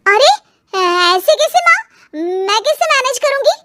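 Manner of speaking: dismayed, rising to a worried question; flustered protest. Acted. Daughter-in-law protesting to mother-in-law, flustered and alarmed.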